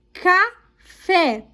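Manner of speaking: café in two beats, stress on FÉ; teacher sounding out "café", last syllable strong; stressed syllable demo. Shown as a demonstration. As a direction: say "café" split up, leaning on "fé". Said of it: "pinte a sílaba tônica".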